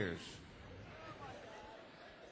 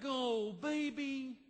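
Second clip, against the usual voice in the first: slow, cooing sympathy; baby your husband with drawn-out sweetness; doting new bride; much slower than usual. Retold or imitated. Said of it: "your new bride of one year would be like".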